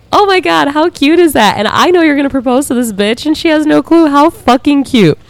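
high-pitched squeal of delight; gushing. Real, not acted. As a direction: squeal it, totally smitten. Gushing over proposal message.

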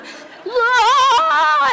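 high, loud squealing laugh; high panicked laugh. Retold or imitated. Recalling flailing underwater, high squealing laugh.

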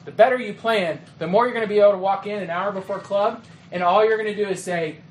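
normal talking voice

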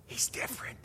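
hushed whispered question. Retold or imitated. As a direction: whisper it so the brother can't hear. Brother answers sister secretly.

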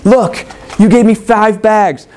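high, eager reporting to the master; excited servants showing off their doubled gold. Acted. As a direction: burst with excitement, voice lifted. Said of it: "you can almost hear the excitement in their words".

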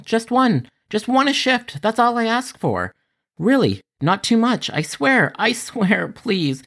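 high, begging plea that rises and rises; desperate pleading. Acted. Call center worker begs for a normal call, high and desperate. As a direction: beg the universe for one normal call.

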